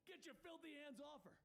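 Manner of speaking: high-pitched indignant yell; outraged man's yell, not full volume. Acted. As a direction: cry out to protect her, pitch leaping up. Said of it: "The man yells".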